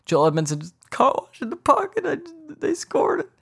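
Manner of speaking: fake-cry the play like a heartbroken fan; mock sobbing. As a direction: pretend to break down in tears. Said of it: "what if I was that emotional about it".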